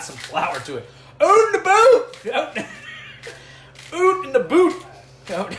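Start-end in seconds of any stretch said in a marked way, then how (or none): 1.2-2.3 high, slow shouted mock Canadian accent; exaggerated Canadian accent. Host mocking Canadian vowels for fun, shouted Canadian accent. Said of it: "should we start speaking Canadian". 3.9-4.8 exaggerated Canadian accent. Host mocking Canadian vowels for fun, shouted Canadian accent. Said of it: "should we start speaking Canadian".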